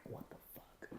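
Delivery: whispered in disbelief; stunned whisper. Retold or imitated. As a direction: breathe it out in shock. Stunned reaction to being released.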